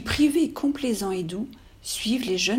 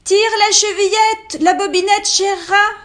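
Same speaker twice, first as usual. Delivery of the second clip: shouting grandmother; 11 semitones higher, 10 dB louder than usual. Acted. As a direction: call out from the bed across the house. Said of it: "lui cria".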